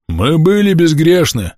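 slow, high accusing cry; man's shout. A narrator's reading. Villager answers Pavel from the side, man's shout. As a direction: shout back slowly, drawing out the words. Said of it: "крикнул кто-то ... взрослым мужским басом".

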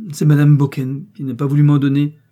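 high, loud, upset protest; tearful exclamation. A narrator's reading. Young maid blaming madame to her mistress, tearful exclamation. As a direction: cry out your innocence, near tears. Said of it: "s'écria l'innocente qui fondit en larmes".

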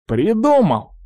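high, excited exclamation; beaming. Acted. Pirate hitting on a rescue plan, beaming with excitement. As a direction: burst out with a bright idea. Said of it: "просиял Дерек".

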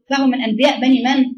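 normal talking voice

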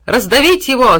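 loud high neigh-like shout; neighing horse. A narrator's reading. Horse neighing for the man to be crushed. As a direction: neigh the verdict. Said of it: "заржала лошадь".

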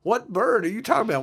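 Ed O'Neill character. Retold or imitated. Sitcom dad answering the neighbor, retold indignant retort. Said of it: "Ed O'Neill, goes".

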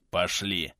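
low, husky order to move; wheezy croak. A narrator's reading. Bearded man urges his friend onward, wheezing hoarsely. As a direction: rasp out the word wearily. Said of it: "просипел бородач".

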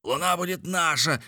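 high, jubilant cry; triumphant shout. A narrator's reading. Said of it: "кричал Николь". (ликующий крик)

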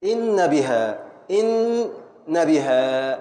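"إن بها" said slowly with a stop on the nun; pausing scansion. Shown as a demonstration. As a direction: break the word at each stop. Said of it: "إن وقفت على النون الأولى". ("إن بها" بوقفة على النون)

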